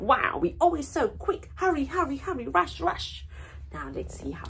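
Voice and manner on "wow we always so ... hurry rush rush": quick, breathless hurry, higher; mock rushing; hurried. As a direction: rush through it like a busy animal. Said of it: "it talks about".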